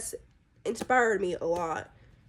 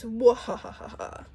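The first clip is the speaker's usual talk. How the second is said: evil cackle; much slower than usual. Acted. Villain quote, evil laugh.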